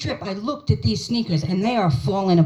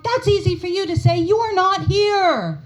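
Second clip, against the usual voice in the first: exasperated retort; 8 semitones higher than usual. Retold or imitated. Retorting to siblings.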